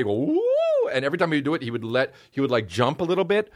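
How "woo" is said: "woo" sliding up high; imitating a teacher's warm-up glide; low-to-high vocal siren. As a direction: swoop from low note to high. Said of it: "He would go from low to high".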